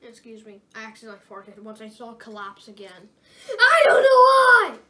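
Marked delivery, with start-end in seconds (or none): 3.5-4.8 laughing shout. Real, not acted. Embarrassed after accidental fart.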